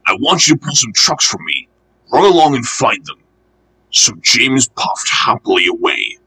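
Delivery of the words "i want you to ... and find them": usual speaking voice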